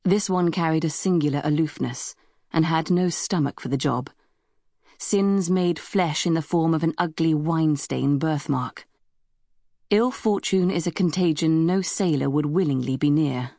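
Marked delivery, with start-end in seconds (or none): none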